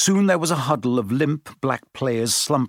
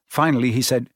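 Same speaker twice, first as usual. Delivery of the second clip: usual speaking voice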